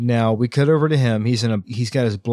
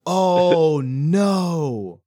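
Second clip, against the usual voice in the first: drawn-out, wailing dismay; slow, dawning horror, played for laughs; mock horrified realization; 4 semitones higher, much slower than usual. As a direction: stretch each word in hammed-up dread. Acting horrified realization at the reveal.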